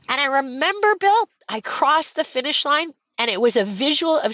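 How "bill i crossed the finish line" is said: normal talking voice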